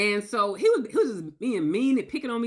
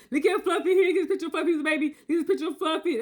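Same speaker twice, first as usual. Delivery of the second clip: higher, laughing gush over the pet; gushing dog owner; 8 semitones higher than usual. Retold or imitated. Boyfriend sending her dog pictures, gushing with a laugh. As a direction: show off the dog's photo with a delighted laugh.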